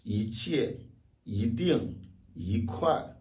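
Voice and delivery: each "yi" rises before falling "jie", "ding", "kuai"; second-tone yi. Shown as a demonstration. Teacher recaps fourth-tone cases, each "yi" rising. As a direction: lift every "yi", drop what follows. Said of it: "pour le quatrième ton".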